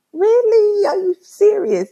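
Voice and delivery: high, loud disbelief; really can't believe it; incredulous. Retold or imitated. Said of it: "but I'm like".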